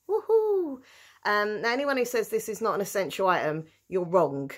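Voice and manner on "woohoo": high excited whoop; little victory cheer. Real, not acted. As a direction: cheer like you've won. Cheering over HP sauce.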